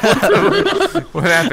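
high laughing what-the; mock alarm, laughing and high; surprised. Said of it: "I was like".

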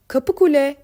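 high, loud call down the corridor; shout. Acted. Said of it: "diye bağırdı Selva". (yüksek sesle bağırış)

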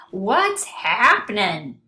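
booming loud greeting; loud blare. A narrator's reading. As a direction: blare it out at full volume. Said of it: "blared Tacky".

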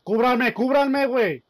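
urgent high shout; cover me shout. Real, not acted. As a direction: yell for cover, loud and high. Calling for cover in firefight.

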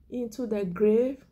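usual speaking voice

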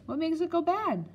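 put-on high-pitched inquisitive child; curious child. A narrator's reading. Boy keeps questioning Nanny about the appendix, curious and high. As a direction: ask it high, like a small child.

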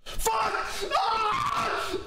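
high startled yell; startled shout. Real, not acted. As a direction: jump scare shout. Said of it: "That actually scared me".

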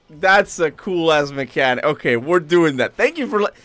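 loud, excited gamer reaction; thrilled. Real, not acted. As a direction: burst out, really impressed. Thrilled by new mechanic.

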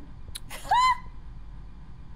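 high shrieking laughter. Real, not acted. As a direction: burst into screaming laughter. Teammate just died.

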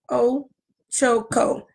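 drawn-out, syllable by syllable Ochoko; slow careful name. Real, not acted. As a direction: sound the name out slowly. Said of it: "I gotta say it slow".

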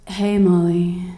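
low, very slow drawl for talking chest hair; put-on gender-bending voice. Chest hair greeting Molly in a job interview, low drawled voice. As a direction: drop the pitch and stretch every word.

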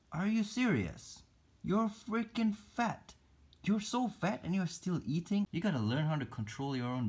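high mock-outraged scolding of pet spider; mock outrage. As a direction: pretend to be appalled at a greedy pet.

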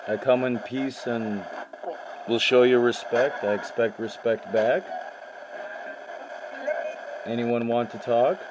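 ordinary delivery

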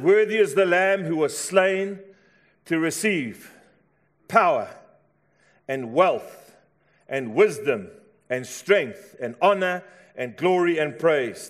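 strong declamation with spaced-out phrases; proclaimed scripture shout. A narrator's reading. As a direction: proclaim each item of praise separately. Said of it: "And they shouted".

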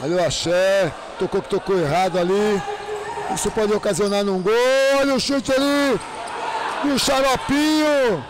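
fast, excited match commentary; play-by-play commentary. Real, not acted. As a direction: call the game live. Live futsal play-by-play.

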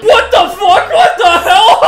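loud, high, shocked outburst; alarmed and incredulous; startled yelling. Real, not acted. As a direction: yell it in comic alarm. Alarmed at the chaos in game.

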